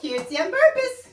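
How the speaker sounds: upbeat catchphrase. Shown as a demonstration. Repeating their stream catchphrase to the co-host, upbeat. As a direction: sell it like a slogan. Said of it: "repeats the catchphrase".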